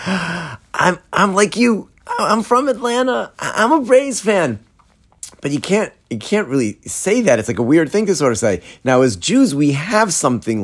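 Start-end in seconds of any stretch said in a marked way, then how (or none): 0.1-4.6 high-pitched, stammering mock excitement; eager recognition. Rabbi silently greeting fellow Braves fans, eager recognition. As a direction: blurt it to a kindred fan, much higher.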